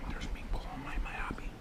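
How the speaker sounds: hushed whisper. Real, not acted. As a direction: whisper it under your breath. Whispered aside.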